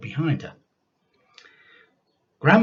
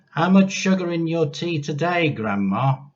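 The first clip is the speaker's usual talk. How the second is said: put-on boy's voice; 8 semitones higher, 6 dB louder than usual. George asks grandma.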